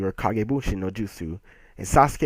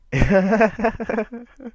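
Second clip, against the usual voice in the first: high, loud awkward laugh; awkward laughter; 13 semitones higher, 5 dB louder than usual. Real, not acted. Vlogger laughs at his own botched reading, awkward laughter. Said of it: "Awkward laughter".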